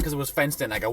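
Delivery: ordinary delivery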